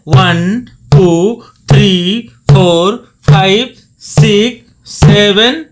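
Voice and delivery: loud, steady count over the drum; counting aloud. Shown as a demonstration. Dholak teacher counting beats for students, loud. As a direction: call out each beat loudly. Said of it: "आपको second practice क्या करना है".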